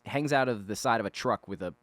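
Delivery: ordinary talk, nothing marked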